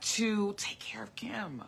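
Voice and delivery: soft breathy whisper, slowed down; hushed self-reminder. Real, not acted. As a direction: whisper it to yourself like a private vow. Repeats line, first whispered.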